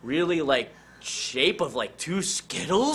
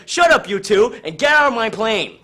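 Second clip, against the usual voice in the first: loud, higher bark at the two passengers; grumpy pilot yelling; 6 semitones higher, 7 dB louder than usual. Acted. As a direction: yell them out of the plane. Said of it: "Pilot's like".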